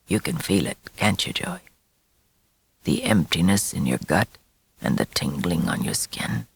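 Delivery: soft, low and insistent; low hushed voice. A narrator's reading. Father murmuring the coming change to Joey. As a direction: lean in and murmur. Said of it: "whispering Joey's future relentlessly".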